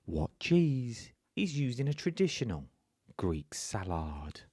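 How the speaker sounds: put-on MasterChef narrator. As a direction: announce it in weighty, separated phrases. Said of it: "I'll do my MasterChef voice".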